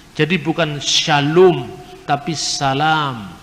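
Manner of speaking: shalom with sh and o set against salam with s and a, slow; shalom versus salam. Shown as a demonstration. Preacher teaching a class how shalom turned into salam. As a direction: contrast shalom and salam slowly. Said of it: "Shinnya menjadi sin".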